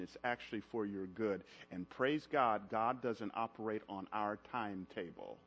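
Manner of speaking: low, soft, intimate preaching voice; hushed sermon close. Real, not acted. Preacher softly reassuring the congregation, hushed and low. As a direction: draw the church in close and quiet.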